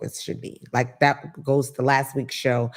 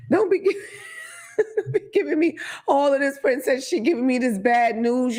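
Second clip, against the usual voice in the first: high, laughing scold; laughing rant; 9 semitones higher than usual. Real, not acted. At the gleeful agent, laughing high scold.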